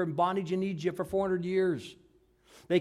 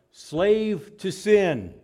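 each word drawn out slow and louder; slow emphatic slave; 4 semitones higher, 6 dB louder, much slower than usual. Acted. Preacher driving the verse home, slow and loud. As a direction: lean hard on slave to sin.

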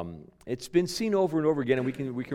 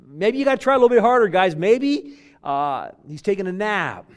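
high, fast, loud taunting; mock them like Elijah did; 7 semitones higher, 8 dB louder than usual. Elijah mocking prophets.

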